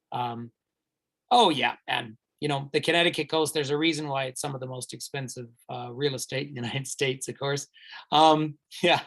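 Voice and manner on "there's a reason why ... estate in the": usual speaking voice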